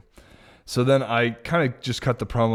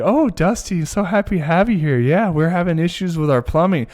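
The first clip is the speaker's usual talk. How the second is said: housewife voice; 7 semitones higher than usual. Retold or imitated. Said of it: "this lady's like".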